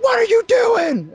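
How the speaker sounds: high, loud, slow motherly shriek; imitating a shocked mom catching him; mom's shout. Mom catching son in basement, high shocked shriek. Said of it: "She's like".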